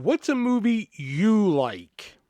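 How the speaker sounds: slow, deliberate odd phrasing; customer's odd wording. Retold or imitated. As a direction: drag the question out word by word. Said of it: "That's how he worded it".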